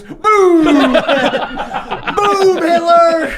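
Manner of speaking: loud, high, laughing cry of boom; mock Hitler outburst. Player mimicking Hitler's bomb shout, loud and laughing. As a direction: yell it out gleefully as a cartoon villain. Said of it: "And he just goes".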